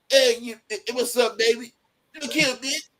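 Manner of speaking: street catcall. Retold or imitated. Lil Earl catcalling quoted.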